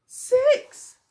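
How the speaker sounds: high, loud triumphant six; counting up to a loud, high six for the children; big climax on six. A narrator's reading. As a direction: land the count with a burst.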